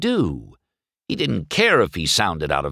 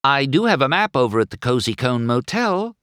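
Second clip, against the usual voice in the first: higher-pitched, eager offer; helpful; 7 semitones higher than usual. A narrator's reading. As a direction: offer help to lost visitors. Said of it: "Sally told the couple helpfully".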